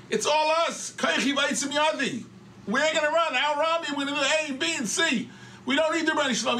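loud, high-pitched proclaiming; boastful captain's speech. Retold or imitated. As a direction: imitate an officer haranguing his troops. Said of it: "he spoke almost like the shame".

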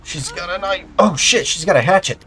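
alarmed shout. Real, not acted. Attacked by woman with hatchet.

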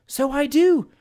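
high-pitched singer's protest; high, put-on woman's voice; Miss Amelia Martin. A narrator's reading. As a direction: answer back in a high voice. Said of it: "responded Miss Amelia Martin".

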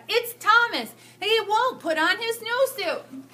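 high, loud, slow tattling wail; a flustered teacher crying for help; frantic complaint. Acted. Teacher wailing to the principal about Thomas. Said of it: "The teacher said".

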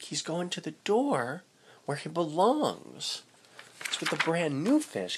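plain reading voice

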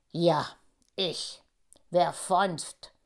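lisping lion king, low; slushy lisp. A narrator's reading. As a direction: lisp every s like a lazy king. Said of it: "lispelte der Löwenkönig".

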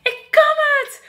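very high, loud triumphant call; proud shout. Acted. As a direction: shout proudly while riding. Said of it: "roept giraf".

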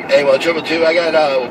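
ordinary talk, nothing marked

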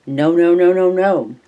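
put-on child's protest. Child fighting the mercurochrome.

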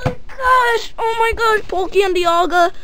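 high, thrilled exclaim; real high-pitched delight; excited squeal. Real, not acted. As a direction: gush over the rare card. Pulls Palkia and Dialga.

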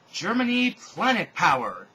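put-on high, loud, slow magical-girl cry; transformation shout. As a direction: belt it out like a transformation call. Hitler transforms with wand.

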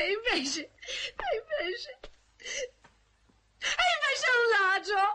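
high, half-laughing, half-crying complaint; laughing through tears. Acted. Woman complaining to the man that the thief tricked her, laugh-crying. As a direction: sob and laugh together as you say it.

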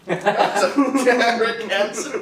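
laughing. Real, not acted. Laughing comment on answer.

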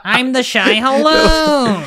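high, slow goofy worm; playful creature introduction; funny worm voice. Acted. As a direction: draw it out like a silly sandworm. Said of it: "he has a really funny voice".

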